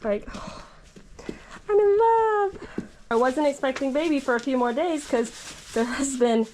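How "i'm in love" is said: high, delighted gush. Real, not acted. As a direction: melt over the new foal, pitch up. Gushing over the foal.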